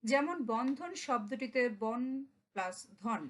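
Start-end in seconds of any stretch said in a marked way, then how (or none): 1.8-3.2 slow, বন and ধন set apart as two beats; syllable split. Shown as a demonstration. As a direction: slowly lay out বন, then ধন. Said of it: "এ দুটি অক্ষর আছে".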